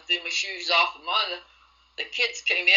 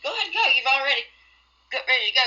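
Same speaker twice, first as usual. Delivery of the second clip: stepmother imitated far higher in pitch; mocking stepmother; 7 semitones higher, 3 dB louder, much faster than usual. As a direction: mimic her coaxing, pushy tone, pitched high. Stepmother urging her to go.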